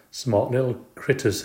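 plain reading voice

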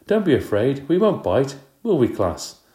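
bright, coaxing reassurance; reassuring teacher. Acted. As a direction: coax a shy child. Teacher reassuring scared Tabitha.

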